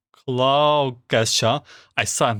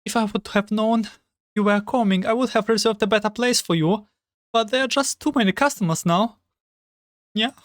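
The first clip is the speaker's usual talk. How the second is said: polite maid, lightly higher voice; apologetic young waitress; maid girl voice; 8 semitones higher than usual. Acted.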